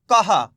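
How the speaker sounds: कहा said as ka then ha. Shown as a demonstration. Teacher models कहा for students. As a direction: split कहा into ka and ha. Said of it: "का के बाद हा का उच्चारण निकालेंगे".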